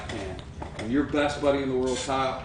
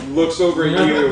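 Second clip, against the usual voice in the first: loud narration; 3 semitones higher, 11 dB louder than usual. Acted. GM builds dramatic narration.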